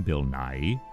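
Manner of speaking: usual speaking voice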